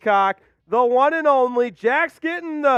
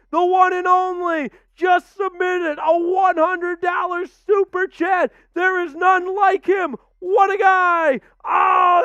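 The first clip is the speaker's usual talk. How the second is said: hyped shouting; 6 semitones higher than usual. Real, not acted. Streamer hyping a hundred dollar super chat, excited shouting. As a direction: scream the big donor's praises. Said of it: "Whew, I'm out of breath".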